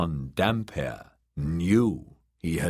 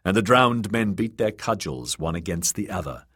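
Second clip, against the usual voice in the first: ordinary delivery, nothing marked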